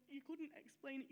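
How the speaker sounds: ordinary talk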